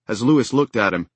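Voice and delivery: ordinary delivery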